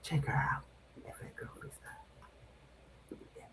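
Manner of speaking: breathy whispered shout-out; whispering. Acted. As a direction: whisper the plug close to the mic. Whispered plug for a guest.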